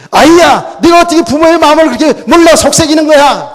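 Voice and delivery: loud, high exasperated parental rebuke; scolding. Retold or imitated. Mary reproaches her son for upsetting his parents, scolding. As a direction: tell the child off at a high pitch, full voice. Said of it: "책망했잖아요".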